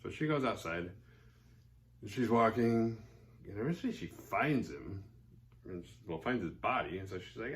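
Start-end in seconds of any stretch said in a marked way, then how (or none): none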